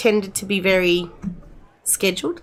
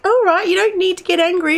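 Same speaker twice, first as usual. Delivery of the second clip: higher mocking teen voice, lightly voiced; mock daughter; 11 semitones higher, 3 dB louder than usual. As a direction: imitate the stroppy daughter, pitched up. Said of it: "she's like".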